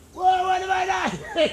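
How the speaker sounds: high, slow, startled cry; startled shout. Retold or imitated. Nine-year-old Wade finding a worm, startled shout. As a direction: let it burst out of you, startled. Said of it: "he shouted".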